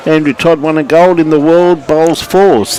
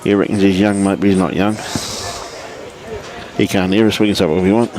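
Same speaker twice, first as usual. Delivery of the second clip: softer, joking behind his back; conspiratorial aside; 4 semitones lower, 6 dB quieter than usual. Real, not acted. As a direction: drop the voice as if he can't hear. Teasing aside, mic muted joke.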